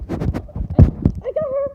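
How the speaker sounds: high, loud triumphant yell; excited shout. Real, not acted. Kid yelling to the camera after catching the dog, excited. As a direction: shout it out as you grab her.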